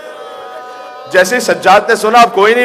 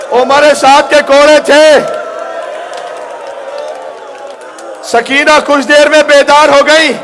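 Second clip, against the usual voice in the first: impassioned majlis preaching; 6 semitones higher than usual. Acted. Mourning recitation.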